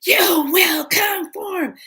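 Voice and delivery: slow, high monster shout; loud, drawn-out imitated monster command; menacing alien nurse. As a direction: screech it like an alien creature. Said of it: "she pulled it up and she goes".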